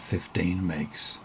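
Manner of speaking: ordinary talk, nothing marked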